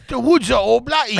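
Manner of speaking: high-pitched mock Australian accent. Shown as a demonstration. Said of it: "I'll do an Australian accent".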